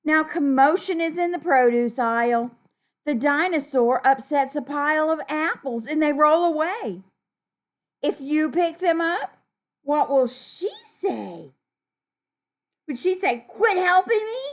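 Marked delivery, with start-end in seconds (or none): 0.0-11.5 put-on animated storytelling to children; story reading. A narrator's reading. 13.5-14.4 high, loud mock-rude protest; rude snap. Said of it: "that would be rude".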